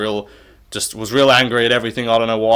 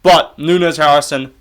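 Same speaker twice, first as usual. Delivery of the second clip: ordinary talk, nothing marked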